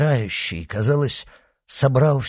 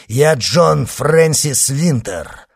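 slow, loud, raised announcement; proclaiming; 6 semitones higher, 7 dB louder, slower than usual. Acted. Avenger repeats his name to executioner, proclaiming. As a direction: spell out your identity forcefully.

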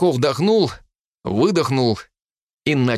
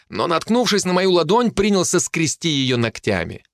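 usual speaking voice

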